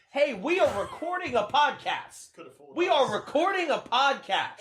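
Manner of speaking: shouting toward another room; calling out. Acted. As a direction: call out to someone elsewhere in the house. Calling out to someone in house.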